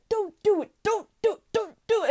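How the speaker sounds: high, repeated pleading; urgent warning. Acted. March warning July from the corner, urgent plea. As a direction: plead in short high bursts.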